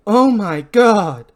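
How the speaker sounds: drawn-out cry of alarm; horrified exclamation. Acted. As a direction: stretch it out in loud horror. Julie finds a bloody boot.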